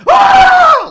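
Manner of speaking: piercing high scream, full volume; imitating the clown's scream; monster shriek. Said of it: "that's the noise he makes".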